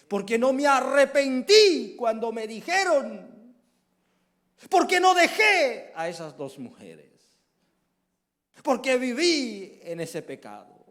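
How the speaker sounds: high, loud wailing regret; anguished cry. Acted. Lost sinner laments his choices in hell, loud anguish.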